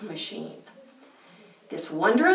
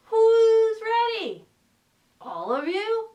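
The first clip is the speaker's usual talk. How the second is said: excited call; 13 semitones higher than usual. Real, not acted. Host rallying kids before bedtime story.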